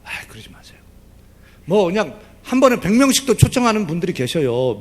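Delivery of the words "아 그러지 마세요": softly whispered urging; sermon whisper. Acted. Pastor whispering the plea to the congregation. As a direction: hush it to the congregation.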